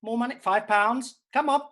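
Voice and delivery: high, loud, pleading for money; begging for tips. Real, not acted. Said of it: "so it's got desperate".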